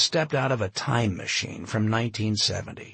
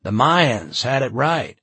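smiling; 4 semitones higher, 7 dB louder than usual. Acted. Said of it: "he was still smiling".

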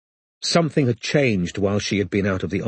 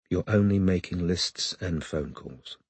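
soft, slow, bitter repetition; bitter quiet echo; 3 semitones lower, 6 dB quieter than usual. A narrator's reading. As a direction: mutter it back to yourself, hurt. Robin bitterly echoing his words.

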